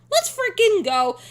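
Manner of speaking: loud hyped cheer; excited. Real, not acted. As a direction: shout it with excitement. Hyped repeat of let's go.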